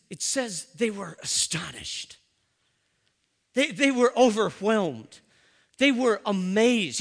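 rising awe. Retold or imitated. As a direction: preach it, swelling on each phrase. Preacher building to a climax.

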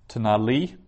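Tanali with LI stressed at the end; stress on final syllable. Shown as a demonstration. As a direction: lean on the LI of Tanali. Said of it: "accent on the li".

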